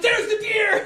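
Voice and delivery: very high, loud mock shout; hammed-up squeal of sudden discovery, near laughter; excited shout. Buddy finally spotting the dead deer, shouted in excitement.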